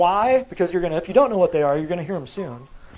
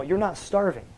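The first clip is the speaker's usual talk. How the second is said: normal talking voice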